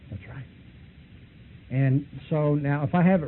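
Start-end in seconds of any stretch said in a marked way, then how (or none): 0.1-0.5 hushed, placid "That's right"; a calm, quiet yes; soft agreement. Retold or imitated. Hare Krishna softly agreeing with the questioner.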